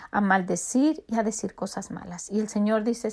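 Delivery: normal talking voice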